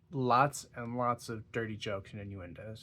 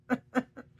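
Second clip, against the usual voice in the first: high laugh; 13 semitones higher, 4 dB louder than usual. Real, not acted. Laughs at story.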